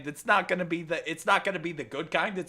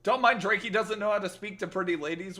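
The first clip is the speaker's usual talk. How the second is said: ordinary talk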